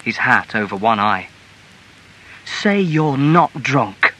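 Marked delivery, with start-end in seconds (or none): 2.6-4.1 slow, insistent challenge, pitched higher; insistent wife; insisting. A narrator's reading. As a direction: press the accusation slowly. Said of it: "she insisted".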